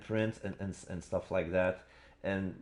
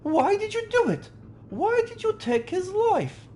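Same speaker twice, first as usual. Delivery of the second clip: put-on very high, louder accusing young woman; Susato voice; 5 dB louder than usual. Player voices Susato accusing the captive, high falsetto. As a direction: falsetto demand for the truth. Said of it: "that's Susato".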